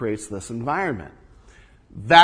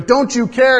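higher and louder, indignant question; accusing plea; 8 semitones higher, 9 dB louder than usual. Retold or imitated. A disciple in the boat rebukes the sleeping Jesus, indignant. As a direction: demand an answer from him. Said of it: "I've just woken Jesus up and rebuked him".

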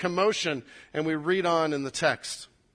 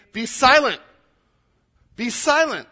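loud, raised command to hush; sharp rebuke; 6 semitones higher, 9 dB louder than usual. Acted. Those in front rebuke the blind man, sharply. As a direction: silence someone forcefully, higher and louder. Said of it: "they rebuked him".

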